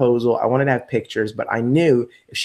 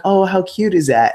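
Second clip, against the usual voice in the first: gushing; 8 semitones higher, 4 dB louder than usual. Retold or imitated. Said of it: "And, and I'm like".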